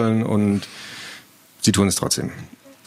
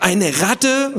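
high, appalled echo question; disgusted question; 9 semitones higher than usual. Acted. Boy reacts to his sister's rat suggestion, disgusted. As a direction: recoil from it in disgust. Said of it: "fragte er angewidert".

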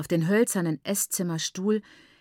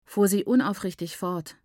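usual speaking voice

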